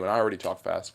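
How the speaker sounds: ordinary talk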